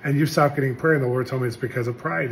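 ordinary talk, nothing marked

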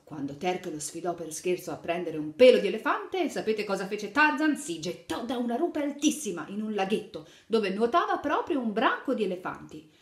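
put-on lively read-aloud to little children; animated picture-book narration; storytelling. A narrator's reading. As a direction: tell the fairy tale brightly to kids.

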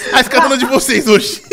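laughing through the words; giggling it out. Real, not acted. Repeats line laughing.

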